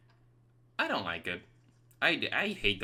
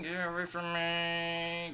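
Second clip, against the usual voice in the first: 1 high drawn-out voice; 7 semitones higher, 3 dB quieter, slower than usual. Pretend. Mock voice at game ghost.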